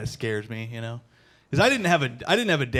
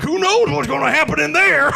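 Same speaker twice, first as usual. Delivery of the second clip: much higher, louder, laughing wonder; giddy clueless voter; 13 semitones higher, 6 dB louder than usual. Retold or imitated. Undecided voter entering the booth, high giddy wonder. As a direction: gasp at the mystery. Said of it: "You go into the booth like".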